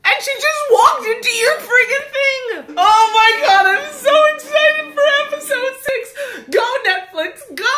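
high, loud gleeful cheer; excited cheering. Real, not acted. Fan cheers the show on, high and gleeful. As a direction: cheer it on breathlessly.

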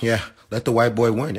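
singsong mock of the league's line; mocking sarcastic. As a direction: sneer it back at the doubters. Mocks the league's reasoning.

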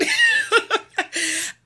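high burst of laughter. Real, not acted. Woman laughing high over her own bike getaway.

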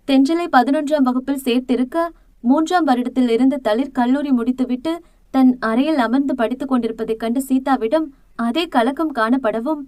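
plain reading voice

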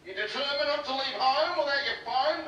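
usual speaking voice

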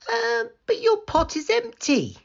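high, questioning uh, but; bemused, very high storybook child voice; puzzled Hannah. Acted. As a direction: Hannah puzzled by the old woman's empty pot, high and wondering. Hannah points out empty pot.

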